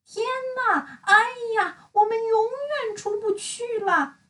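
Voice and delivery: high, tearful wail; despairing character. Acted. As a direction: sob that they'll never escape. Trapped friends lamenting.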